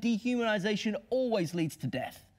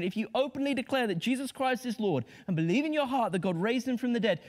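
normal talking voice

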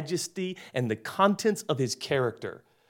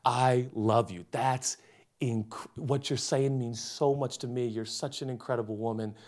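tender reassurance; 4 semitones lower, 5 dB quieter than usual. Retold or imitated. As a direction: soothe her gently, then sneak in the pitch. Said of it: "you put your arms around her and you say".

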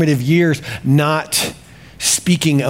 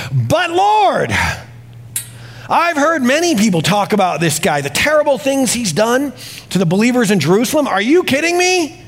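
much higher, incredulous and pleading; freaked-out protest; 7 semitones higher than usual. Retold or imitated. Ananias protests the Lord's order, high and incredulous. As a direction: cry out in disbelief at the order. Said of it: "He's all calm up until this point".

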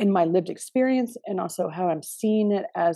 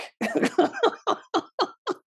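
high laugh; 14 semitones higher than usual. Real, not acted. Laughing at ominous answer.

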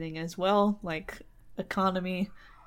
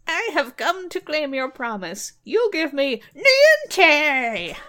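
high, loud, slow dwarf demand; froggy dwarf voice; 8 semitones higher, 8 dB louder, slower than usual. Acted. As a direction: drag out the demand like an angry dwarf. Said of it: "said the dwarf in a froggy voice".

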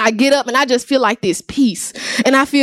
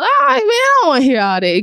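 put-on higher, brushing off the preacher as a teen; dismissive brush-off; 6 semitones higher than usual. Young man to the pastor, dismissive and impatient. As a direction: wave him off, not wanting to hear it.

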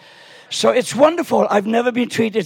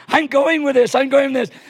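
high-pitched, hammed-up excitement; mock frenzy; 6 semitones higher, 3 dB louder than usual. Grandpa giving in to the fairy dust, mock frenzy. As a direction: play-act being swept away.

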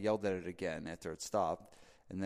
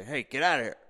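a higher, louder mock yell at a bear; retold yell; 5 semitones higher, 8 dB louder than usual. Joe yelling at a bear at the feeder, mock yell. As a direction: imitate the host yelling the bear away. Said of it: "I heard Joe yell".

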